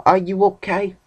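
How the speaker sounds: put-on high-pitched little kid asking; five-year-old voice. Five-year-old checking on the hurt girl, high childish voice. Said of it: "Like, a five-year-old would say".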